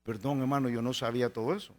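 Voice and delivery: mild sheepish sorry; embarrassed apology. Retold or imitated. (pastor apenado pidiendo perdón en voz baja)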